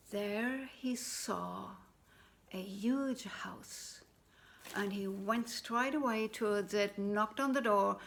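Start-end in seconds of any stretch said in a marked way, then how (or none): 0.1-4.0 slow, breathy whisper; suspenseful hush. A narrator's reading. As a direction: draw out the wonder. Suspenseful discovery.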